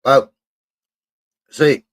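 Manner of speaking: talking with mouth full. Real, not acted. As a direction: speak through a mouthful of crisps. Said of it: "It's like I just put a Monster Munch in my mouth".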